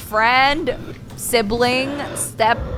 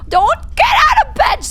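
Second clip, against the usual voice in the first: screaming a warning, high and loud; panicked shout at the screen; frantic yell; 6 dB louder than usual. Real, not acted. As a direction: yell at him not to get up. Yelling warning at video character.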